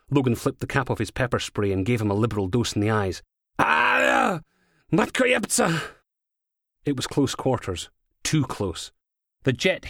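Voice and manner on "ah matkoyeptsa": high, loud howl then curse; pained cry. A narrator's reading. Intruder sprayed in the eyes howls and swears. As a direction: yell out as the spray stings.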